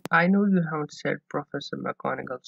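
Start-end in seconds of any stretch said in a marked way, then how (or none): 0.1-0.9 louder, exasperated yet admiring; half exasperated, half admiring. A narrator's reading. McGonagall to Dumbledore, exasperated but admiring. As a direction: push back while secretly impressed. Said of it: "sound half exasperated, half admiring".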